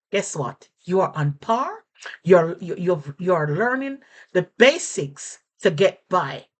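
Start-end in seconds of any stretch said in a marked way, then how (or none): none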